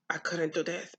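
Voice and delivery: ordinary talk, nothing marked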